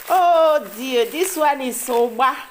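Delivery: high, loud delighted cry; thrilled shriek over a treasured gift; excited squeal. Real, not acted. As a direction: burst out with real joy at the find. Excited over unwrapped oil bean.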